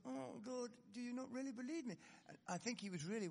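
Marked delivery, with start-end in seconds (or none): 0.1-2.0 hurt Peter. Retold or imitated. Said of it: "'Cause he thought".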